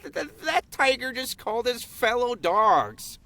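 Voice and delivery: high, incredulous cartoon dog. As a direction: stammer in disbelief like a pup. Dog voice in script.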